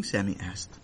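plain reading voice

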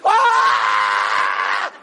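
long loud mock bawl; drawn-out acted child's howl; hysterical wail. Boy breaking down to mommy, wailing.

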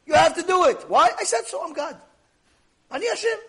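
high, loud, emphatic decree; God's stern command. Acted. God telling people they must obey him, stern command.

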